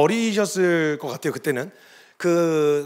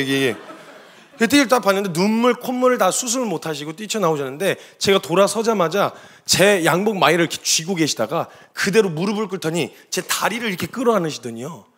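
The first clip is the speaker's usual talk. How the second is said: ordinary talk, nothing marked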